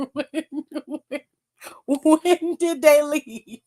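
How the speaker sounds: slow, disbelieving repeat; incredulous. Real, not acted. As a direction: drag it out in disbelief. Mocking disbelief at the return.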